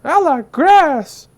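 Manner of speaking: high, slow, drawn-out cartoon pig voice; squealing "Woo!" then a slow, high pig line; first little pig. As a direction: stretch it out like a giddy little pig. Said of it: "one pig was like".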